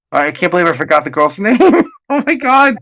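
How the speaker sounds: usual speaking voice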